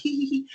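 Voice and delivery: high, soft little titter; tiny squeaky mock giggle; imitated nervous giggle. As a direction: mimic a petrified girl's timid laugh. Mimics petrified girl's nervous laugh.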